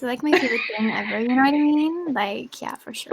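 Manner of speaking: lightly put-on Valley girl American English. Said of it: "I sound like a Valley girl".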